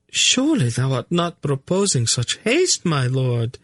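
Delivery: high, gasping noble lady; a shocked lady, breathless with dismay; high lady voice caught in a gasp. Acted. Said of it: "Narina gasped".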